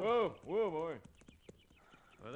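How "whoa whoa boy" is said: loud, high call to steady a horse; whoa-ing at a skittish horse, loud. Acted. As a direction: shout the horse down, higher. Calming a rearing horse.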